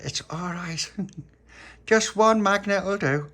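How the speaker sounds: put-on high child voice, growing loud and slow; Little Pappy, reassuring. A narrator's reading. Little Pappy reassuring friends about magnets, high and emphatic. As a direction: reassure brightly, swelling on the second sentence.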